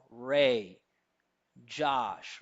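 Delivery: RAY and JOSH each loud, long and wide open; stressed, open vowels. Shown as a demonstration. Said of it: "in the stressed form".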